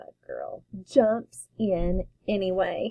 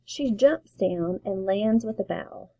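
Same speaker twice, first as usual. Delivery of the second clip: ordinary delivery, nothing marked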